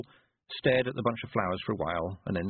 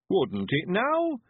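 put-on old man voice; 10 semitones higher, 3 dB louder, much slower than usual.

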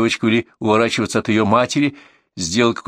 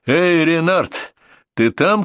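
higher, drawn-out call; 7 semitones higher, much slower than usual. A narrator's reading. Narrator hails the hiding Renard, calling out. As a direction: call out to a hidden man. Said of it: "крикнул я".

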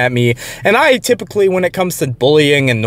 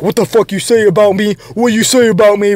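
higher-pitched mimicry of someone acting tough; mock tough-guy; 6 semitones higher than usual. Coworker confronting him after being told off, mock tough-guy. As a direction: imitate a confrontational posturer getting in someone's face. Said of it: "act tough around you".

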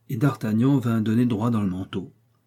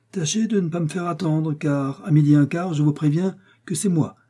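higher-pitched, insistent threat; threatening warning; 6 semitones higher than usual. A narrator's reading. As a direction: warn him not to be late. Athos threatens before the duel. (avertissement menaçant)

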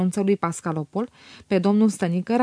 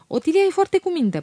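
protesting; 10 semitones higher than usual. A narrator's reading. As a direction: push back hard in his defence. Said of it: "protestă Felix".

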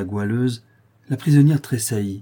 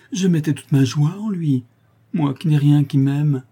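anguished lament; 5 semitones higher, 5 dB louder than usual. A narrator's reading. Mother grieving over her child.

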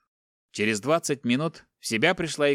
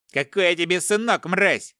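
higher, louder furious snap; angry retort; 7 semitones higher, 4 dB louder than usual. Acted. Son furiously rejecting the captor's word. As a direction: spit the insult back.

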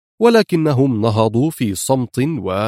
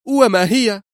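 high, eager question; leaning in for the answer; eager ask; 9 semitones higher than usual. Acted. Woman presses her rescuer, high and eager. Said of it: "سألته في لهفة".

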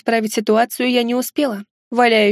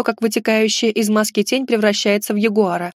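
plain reading voice

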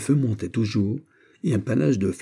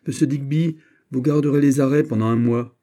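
raised, higher voice of authority; stern command; 5 semitones higher, 5 dB louder than usual. Acted. General puts his officer under arrest, stern. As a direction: pronounce the punishment firmly.

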